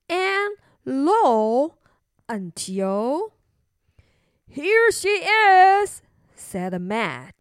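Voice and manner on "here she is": high, loud, drawn-out call; calling out a find. Acted. Man finding the hiding princess, calling out. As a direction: announce the discovery to the searchers.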